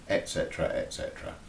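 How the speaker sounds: et cetera, fading to a breathy hush; weary trailing off. Real, not acted. Local reporter tiring of old issues, fading dismissively. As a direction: let the list die away, unimpressed.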